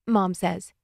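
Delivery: usual speaking voice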